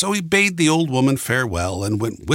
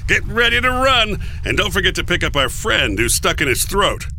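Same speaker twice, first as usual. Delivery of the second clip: raised, high urgent call; rallying shout; 8 semitones higher than usual. A narrator's reading. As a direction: call out orders to the fleeing group. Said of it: "Coyote shouted".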